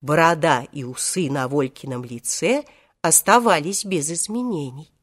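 usual speaking voice

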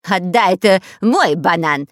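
loud, slow insistent demand; spy yelling to get it back; shouting. A narrator's reading. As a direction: shout it out, stretching the words. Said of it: "кричал шпион Дырка".

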